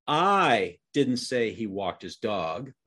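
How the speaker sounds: I drawn out and punched, the rest slow; stress on I. Shown as a demonstration. Demonstrating how tone changes the sentence, stress on I. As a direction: lean long and hard on the opening I. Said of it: "show you how this works just by using tone".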